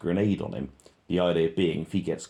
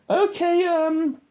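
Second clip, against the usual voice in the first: much higher, louder mock radio talk; squeaky radio voice; 7 dB louder, much slower than usual. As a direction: mimic a soldier on the radio, squeaky. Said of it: "he's on the radio".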